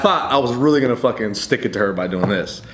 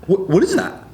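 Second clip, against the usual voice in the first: feigned innocence; 6 semitones higher than usual. Retold or imitated. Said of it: "Fucking going for the Oscar".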